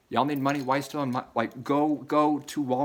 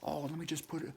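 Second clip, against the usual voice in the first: softer, higher offhand voice; dismissive friend; 5 semitones higher, 10 dB quieter than usual. Retold or imitated. Friend ignoring the plea, soft offhand mock voice. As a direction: brush him off lightly.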